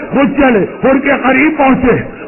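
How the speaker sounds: ordinary talk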